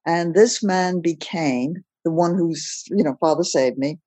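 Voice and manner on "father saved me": ordinary delivery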